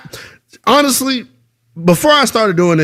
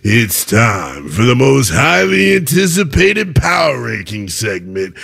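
slow, booming big announcer build-up; announcer intro; 5 semitones lower, slower than usual. Acted. Host introduces his ranking segment, booming announcer voice. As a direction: hype the segment like a ring announcer.

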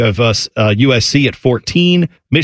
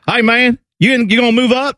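high-pitched imitated dude accent from Ohio; Californian-style Ohio accent; 11 semitones higher than usual. Stranger in line at the DMV, Ohio dude accent. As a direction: mimic an Ohioan with a California accent. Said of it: "Central Ohio sounds like that".

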